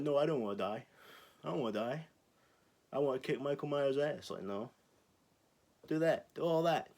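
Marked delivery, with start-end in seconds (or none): 0.0-4.3 mock panicky victim. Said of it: "Instead of being like". 5.9-6.9 higher, louder push; loud insistence. Real, not acted. Urging the killer on loudly.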